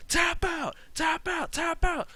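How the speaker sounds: high repeated yell to tap out. Retold or imitated. Wrestler yelling at trapped opponent, high repeated yell. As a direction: bark it at him three times. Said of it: "He's yelling".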